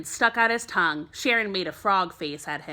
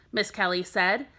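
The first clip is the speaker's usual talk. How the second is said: usual speaking voice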